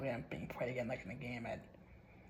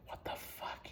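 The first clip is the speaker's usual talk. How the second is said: breathless whispered curse; spooked whisper; 8 dB quieter, slower than usual. Real, not acted. Reading the haunted chat alone, a spooked whisper. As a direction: hush it like something might hear.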